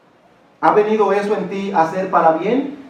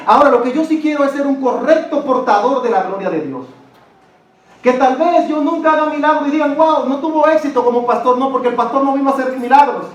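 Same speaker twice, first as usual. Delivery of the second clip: higher, louder fervent preaching; preaching at full force; 8 semitones higher, 5 dB louder than usual. Acted. Pastor preaching to the congregation, high and loud. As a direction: proclaim it from the pulpit.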